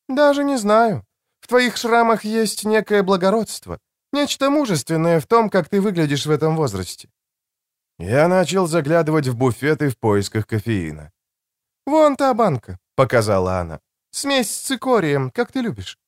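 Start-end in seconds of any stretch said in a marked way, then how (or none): 0.1-7.1 high-pitched voice for a woman; higher female character voice; woman's voice. A narrator's reading. 11.9-12.8 high-pitched voice for a woman; higher female character voice; woman's voice. A narrator's reading. 14.1-16.0 high-pitched voice for a woman; higher female character voice; woman's voice. A narrator's reading.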